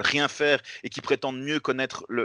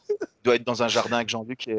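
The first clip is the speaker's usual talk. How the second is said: ordinary delivery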